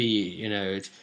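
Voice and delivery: ordinary delivery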